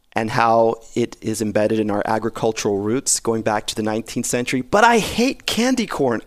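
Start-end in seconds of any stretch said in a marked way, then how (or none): 4.6-6.2 pitched up high on I, real exasperation; emphatic confession. Real, not acted. Host confessing to his cohost that he hates candy corn, pitched high on I. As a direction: blurt out the hated candy.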